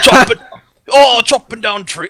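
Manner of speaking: hammed-up dwarf, ending in a high wail; hapless dwarf. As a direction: play a dwarf chopping away, then caught out. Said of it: "dwarf is walking by".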